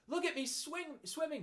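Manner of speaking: very high, excited squeak; squeaky little Roo. A narrator's reading. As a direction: squeal it like a proud small child. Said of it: "squeaked Roo".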